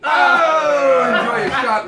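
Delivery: loud laughing jeer; laughing shout. Real, not acted. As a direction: gloat through a laugh. Teasing friend over bad pull.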